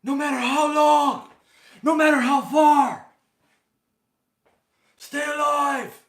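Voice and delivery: loud, high, impassioned movie-hero shout; bad impression, bellowing a desperate film line; hammed-up dramatic movie quote, shouted at full voice; Daniel Day-Lewis. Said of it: "that was a bad impression of Daniel Day-Lewis".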